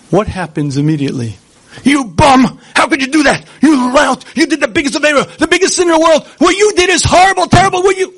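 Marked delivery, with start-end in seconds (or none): 1.8-8.1 high, loud, hammed-up berating shout; mock outraged yell, rising ever higher; accusing inner voice. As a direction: scold yourself like a furious accuser. The Sultan berating after sin.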